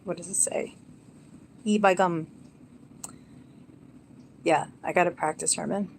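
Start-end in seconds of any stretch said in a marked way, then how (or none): 1.6-2.3 put-on northern English accent. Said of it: "we used to say".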